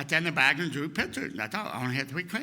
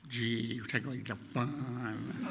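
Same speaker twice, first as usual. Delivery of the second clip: softer, slow, deflated grumble; sulky kid; 5 semitones lower, 9 dB quieter, slower than usual. Retold or imitated. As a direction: let the fun drain out of it. Kid sulks after mother's rule.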